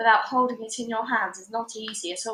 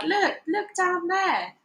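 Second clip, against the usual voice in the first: alarmed; 8 semitones higher, slower than usual. A narrator's reading. Wife alarmed pointing below.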